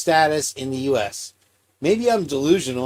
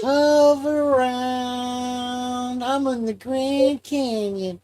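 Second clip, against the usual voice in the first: much higher, very slow drawn-out ad voice; Hoveround commercial; 10 semitones higher, much slower than usual. Retold or imitated. Cohost imitating the old Hoveround ad, high and slow. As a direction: stretch it out like a cheesy TV ad. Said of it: "those commercials from back in the day".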